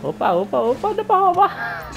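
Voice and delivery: high squealing excitement; excited squeal. Real, not acted. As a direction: shriek with excited laughter. Excited at a shortcut.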